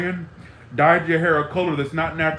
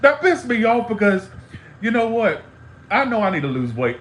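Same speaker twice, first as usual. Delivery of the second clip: fired up; 6 semitones higher, 3 dB louder than usual. Real, not acted. Ranting about the comment.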